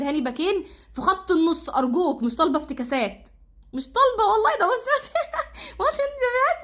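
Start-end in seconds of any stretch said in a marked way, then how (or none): 3.7-6.6 laughing high rant. Real, not acted. Fan mocking coach's lineup, high laughing disbelief. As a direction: pitch jumps way up, laughing in disbelief.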